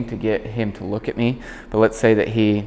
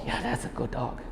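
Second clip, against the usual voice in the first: whispered, much softer good dog; soft praise; 13 dB quieter than usual. Real, not acted. Trainer softly praises the dog at her side. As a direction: murmur it just to the dog.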